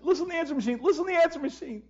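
high, pressing repeated urging; urgent distraught plea. Retold or imitated. Clay urging the pastor to hear the message, high urgent plea. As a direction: push him toward the machine in panic.

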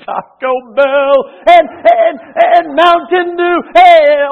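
ordinary delivery

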